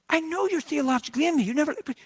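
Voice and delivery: high, halting, overwhelmed voice; weepy. Retold or imitated. Hunter talking to the Lord, retold weepy and high. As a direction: imitate a weepy, high-pitched confession. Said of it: "I was weepy".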